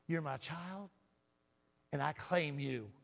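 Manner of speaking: ordinary talk, nothing marked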